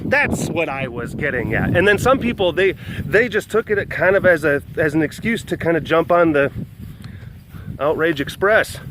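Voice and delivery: usual speaking voice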